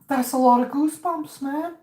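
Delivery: squeaky high exclamation after a shiver; high-pitched reaction. Real, not acted. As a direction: stay way up high, riding the shiver. Reacting to a rush of goosebumps.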